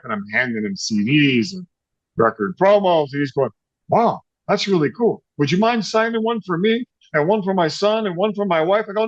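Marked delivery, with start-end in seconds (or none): 3.9-8.9 high, eager retold fan voice; starstruck request. Owner asking musician for autographs, starstruck. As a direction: ask like a thrilled fan, pitched up.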